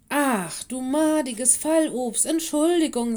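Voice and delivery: loud, high exclamation of dismay; startled cursing. A narrator's reading. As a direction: burst out, then excuse yourself. Teacher curses, then apologises.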